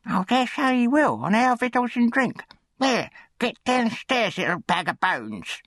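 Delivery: very high-pitched, grudging scold; scolding undertaker's wife. A narrator's reading. Undertaker's wife sends the new boy downstairs, high scolding. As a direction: order the boy about in a high, sour voice.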